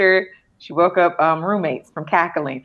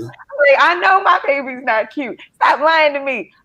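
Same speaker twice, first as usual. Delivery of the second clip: mock exasperation; 7 semitones higher than usual. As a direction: beg them to stop lying, pitched high.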